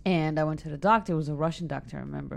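ordinary delivery

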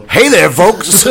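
loud, high showman greeting; Mel Brooks. Retold or imitated. Retelling Brooks explaining his ban from the movie, loud hammy greeting. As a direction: burst in like a hammy entertainer. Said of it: "He goes, 'Cause, uh, Gene".

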